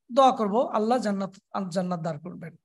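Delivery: ordinary talk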